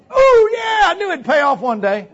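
high, loud whoop; playful victory cheer; jubilant. Pretend. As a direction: crow with triumph, easing after the first shout. Jubilant cheer about older fellows first.

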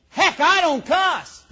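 mock indignant denial. People insisting they don't cuss, mock indignant. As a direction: protest it slowly in a high mocking voice. Mimicking people who deny cussing.

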